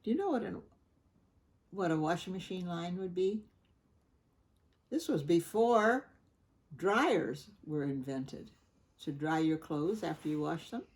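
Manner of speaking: ordinary delivery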